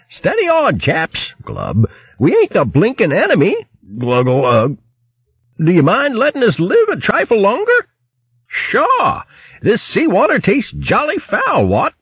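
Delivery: posh hare gurgling as he's dragged off; spluttering hare. Acted. Hare spluttering farewell to the vermin, gargling high.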